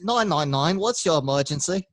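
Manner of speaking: a hammed-up British accent; a loud British operator in a put-on accent. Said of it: "I can't do the British accent at all".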